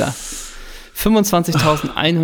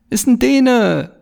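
ordinary talk

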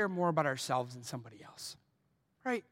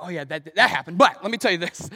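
self-justifying gossip; 11 dB louder than usual. Retold or imitated. Retelling his own self-justifying gossip.

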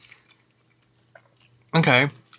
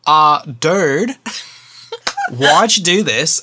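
mock complaint with a drawn-out "dude"; lightly imitated exasperated husband; Justin; 2 semitones higher, 3 dB louder, much slower than usual. Wife imitates husband in their marriage, mock complaint. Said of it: "based on Justin saying".

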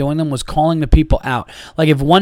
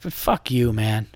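muttered curse; 4 semitones lower, 6 dB quieter, much slower than usual. Retold or imitated. Muttered insult at the man.

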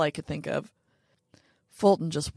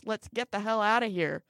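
usual speaking voice

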